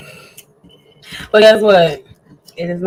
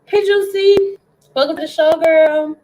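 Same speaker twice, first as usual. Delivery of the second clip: excited greeting; 9 semitones higher than usual. Real, not acted.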